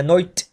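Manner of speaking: "नोइट" with an oi vowel for night; English-accent pronunciation. Shown as a demonstration. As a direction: stretch "नोइट" in a put-on British accent. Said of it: "नाइट को आपने बोलना है".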